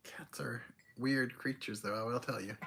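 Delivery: hushed, slow confiding whisper; whispered aside. Real, not acted. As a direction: lean in and whisper it like a secret. Wry aside about the cat.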